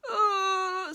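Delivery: loud, high agonised groan; long wailing moan; suffering tree. Acted. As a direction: groan loudly in pain. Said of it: "says the tree".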